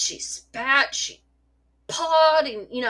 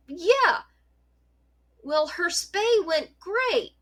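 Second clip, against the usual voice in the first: mock vet tech; 7 semitones higher, much slower than usual. Retelling the vet tech's reply.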